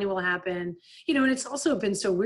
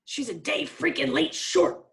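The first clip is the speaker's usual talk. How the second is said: high, mixed-up frustrated mutter; garbled coach; 7 semitones higher than usual. Retold or imitated. Coach mixing up sayings on the bench, frustrated. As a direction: mangle the phrase in frustration. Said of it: "And he's like".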